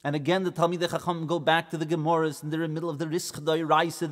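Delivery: ordinary delivery, nothing marked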